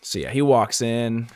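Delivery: ordinary talk, nothing marked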